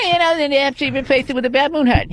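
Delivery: high-pitched whining host voice; whiny Leno. Acted. Comic throwing a joke to the band member, whiny high impression. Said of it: "whining in a high, a high-pitched fucking voice".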